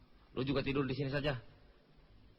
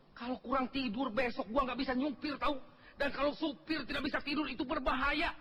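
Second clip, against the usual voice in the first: high-pitched tirade turning into a speech; ranting mock oration; 11 semitones higher than usual. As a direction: harangue the crowd about safety in a high voice. Scolding swells into mock public speech. (omelan bernada tinggi ala orator)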